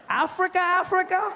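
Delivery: very high, loud questioning Africa?; disbelieving; incredulous. Retold or imitated. A Miami guy doubts he is African, very high and loud. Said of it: "He's like".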